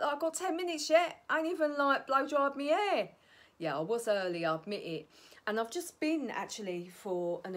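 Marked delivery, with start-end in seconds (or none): 0.0-3.1 higher, lightly voiced flustered self; a hint of a fussing pensioner; imitating herself. Quoting herself being early, higher and mock flustered. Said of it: "so I'm like".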